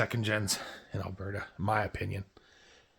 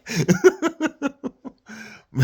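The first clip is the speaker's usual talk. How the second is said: high laugh; 4 dB louder than usual. Real, not acted. Laughing at boss's remark.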